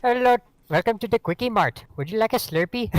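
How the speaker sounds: high, loud put-on Indian shopkeeper accent; mock Indian clerk accent. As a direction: play a cheesy Indian store clerk. Quickie Mart clerk parody.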